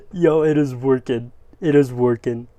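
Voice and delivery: disbelief turning into loud joy; loud elated yelling as it boots; dawning joy. Real, not acted. Laptop powers on after doubt.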